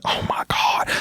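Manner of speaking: breathy, voiceless exasperation; acted-out hushed dismay; whispered dismay. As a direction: whisper it in mock despair. Acted dismay at five more minutes.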